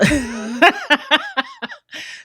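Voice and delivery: high-pitched burst of laughter. Real, not acted. Laughing about the hairstylist's reaction, high laugh. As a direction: crack up high.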